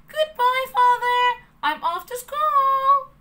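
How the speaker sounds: high, loud call to the house. Acted. Pinocchio calls goodbye to his father, loud. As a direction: sing it out toward the door.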